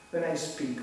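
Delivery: usual speaking voice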